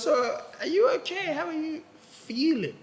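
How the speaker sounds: soft wife checking on her husband; gentle wife. Retold or imitated. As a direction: ask tenderly, like a worried wife. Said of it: "she goes".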